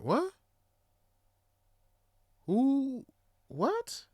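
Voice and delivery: high, sharp what, who, what; stunned disbelief. Retold or imitated. Told his date is not Tracy, asks in high disbelief. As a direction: caught totally off guard, voice jumping up.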